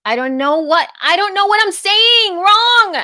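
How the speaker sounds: high, loud exasperated wail; re-enacted frustrated outburst, rising in pitch; retold frustration. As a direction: act out being at your wits' end, stretching the last words. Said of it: "it was so frustrating".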